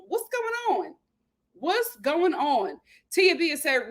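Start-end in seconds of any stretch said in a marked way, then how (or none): 0.1-0.9 high and light, at normal pace; high-pitched disbelief. Real, not acted. Incredulous question, first take. 1.5-2.8 half-speed, every word stretched; slow, drawn-out disbelief. Real, not acted. Same question repeated slowly.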